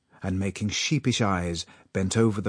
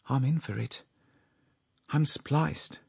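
raised-pitch, understated dismay; limp with horror; 7 semitones higher than usual. A narrator's reading. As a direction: think it aloud, mildly horrified. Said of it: "going limp with horror".

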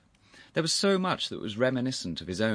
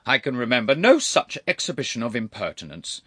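loud, high reproof; indignant rebuke; 5 semitones higher, 9 dB louder than usual. Acted. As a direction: scold the impertinent nephew loudly. Uncle rebukes intruding nephew.

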